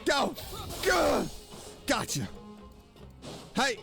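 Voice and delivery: ordinary talk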